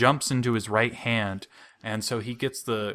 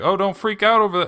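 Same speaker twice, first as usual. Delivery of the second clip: breezy dad; 10 semitones higher, 6 dB louder, faster than usual. Retold or imitated. Dad voiced.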